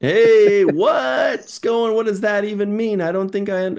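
loud, high mock outburst; Riker. Riker demanding what it means, hammed-up baffled shout. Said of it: "I was waiting for Riker to say".

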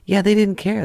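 high, breezy imitation of the assistant; imitating the assistant. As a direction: toss it off lightly in his voice. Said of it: "He goes".

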